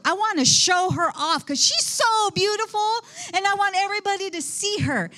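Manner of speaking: a proud king, pitched high and bright with excitement; boastful king. Retold or imitated. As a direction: show off his beautiful queen to the whole party.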